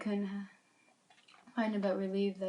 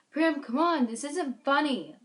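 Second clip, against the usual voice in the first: loud, higher yell, slowing near the end; 7 semitones higher, 11 dB louder, slower than usual. Acted. Aki yelling at her sister behind the door. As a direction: call out loudly, half afraid. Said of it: "I yelled".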